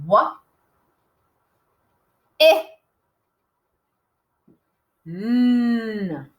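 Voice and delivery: isolated sounds wa, eh, n with long pauses; "wa ... eh ... n", the n held; sounding out. Shown as a demonstration. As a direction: sound out "wa, eh, n" one phoneme at a time. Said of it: "let's sound out when".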